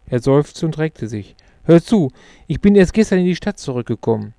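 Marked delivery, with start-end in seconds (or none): 1.6-4.3 quick, higher and louder friend; Luke. A narrator's reading. Old schoolmate starting his news, fast higher character voice. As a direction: launch into the news briskly, pitched up.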